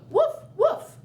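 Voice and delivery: high, loud playful woofs; dog woofs. Shown as a demonstration. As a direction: bark like a puppy. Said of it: "you go".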